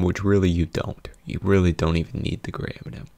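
usual speaking voice

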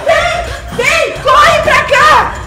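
high, loud frantic calls; urgent shouting. Real, not acted. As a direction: yell at her to hurry. Urging friend to run.

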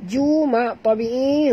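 normal talking voice

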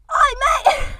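put-on loud, high Australian-accent call; mock Australian shout. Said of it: "trying to do that dumb accent".

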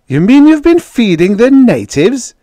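high, loud disbelieving question; incredulous outburst. A narrator's reading. Ned learns they have fed the natives, incredulous. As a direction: exclaim in astonishment.

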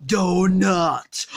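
put-on low slurred drunk voice; sluggish, drunk and lazy; Homer Simpson. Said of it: "You're freaking Homer Simpson".

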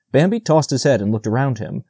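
ordinary delivery

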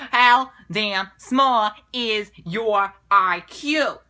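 slow, deliberate put-down with every word drawn out; slow sarcastic jab; slow mocking retort. Real, not acted. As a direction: spell out the insult slowly and pointedly. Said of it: "I'm so mad".

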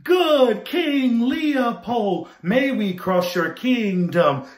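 loud, drawn-out rhythmic call. Shown as a demonstration. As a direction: call the verse out slowly and loudly. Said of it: "calling voice".

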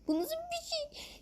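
very high, slow, soft squeak; squeaky voice. Pretend. Gamer mimicking a strange game sound, tiny high squeak. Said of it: "Abi ses nasıl bir şey".

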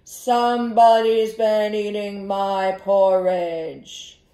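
loud, slow booming bear voice; slow big papa bear boom. Shown as a demonstration. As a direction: boom it slowly for the class to copy. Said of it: "say that in the big voice".

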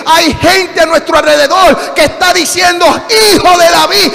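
loud, high pulpit shout; full-force preaching. Real, not acted. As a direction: drive it home at full volume.

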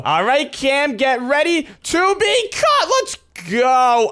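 high, drawn-out build-up to the catch; triumphant call. Pretend. Seeker about to catch Cam, triumphant high call. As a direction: stretch it out before pouncing. Pouncing on a supposedly found player.

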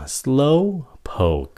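"Slowpoke" high on "Slow", drawn out, falling on "poke"; slow, careful. Shown as a demonstration. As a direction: stretch "Slowpoke" and release the K. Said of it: "Nói chậm nè nha".

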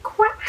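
high quack; quacking duck. Acted. Parent voicing the duck for her child, high quack. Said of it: "said the duck".

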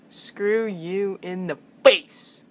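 slow scornful send-off; spiteful. Real, not acted. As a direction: spell it out to her slowly. Outro jab at the girl.

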